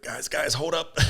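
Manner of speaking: high, laughing "guys, guys, hold up"; laughing call. Retold or imitated. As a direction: call them off through a laugh. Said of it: "I was like".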